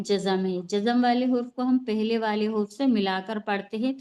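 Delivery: ordinary talk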